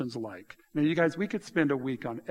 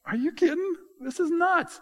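much higher, strained incredulity; comic exasperation; mock disbelief; 13 semitones higher than usual. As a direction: squeak it out in disbelief. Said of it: "I'm like".

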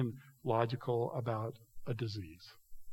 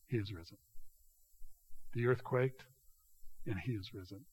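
hushed awe; 5 semitones lower, 8 dB quieter than usual. Real, not acted. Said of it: "most important event in all".

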